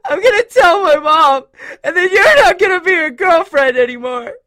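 put-on high, very loud child's wailing; completely sobbing. A narrator's reading. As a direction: wail the threat through sobs. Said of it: "completely sobbing".

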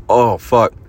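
sudden loud pleasured groan; loud moan. Acted. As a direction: let it burst out loud. Moaning during rough sex.

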